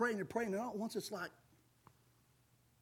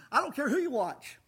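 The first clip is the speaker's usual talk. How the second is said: normal talking voice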